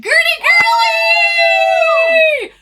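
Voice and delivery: high, loud hype shout; excited shout. Real, not acted. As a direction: yell the donor's name with joy.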